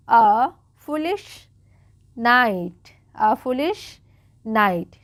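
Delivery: drawn-out words with pauses between; slow read-aloud. Shown as a demonstration. Teacher sounding out words for learners, slow and deliberate. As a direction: read very slowly for a beginner. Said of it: "बिल्कुल आराम आराम से पढ़ रही हूँ".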